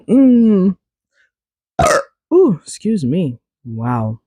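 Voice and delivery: after a cough, clearing throat. Real, not acted. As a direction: catch your breath after coughing. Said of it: "That was a big one".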